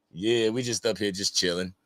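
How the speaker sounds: usual speaking voice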